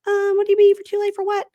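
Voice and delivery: high, girlish imitation of her apology; squeaky put-on voice of a nervous girlfriend; mimicking girlfriend. Retelling to co-host, imitating the girlfriend in a high put-on voice.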